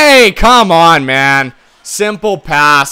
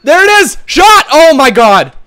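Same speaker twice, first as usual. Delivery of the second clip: much higher and louder, shouting; wild excitement; 10 semitones higher, 6 dB louder than usual. Real, not acted. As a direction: scream as the chance opens up. Celebrating then complaining, loud.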